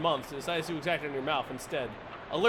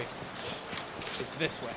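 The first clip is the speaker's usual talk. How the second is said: slow drawn-out whisper of "It's this way"; 4 semitones lower, 6 dB quieter, much slower than usual. Shown as a demonstration. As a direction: breathe "It's this way" slowly, no voice. Repeated line, second whispered.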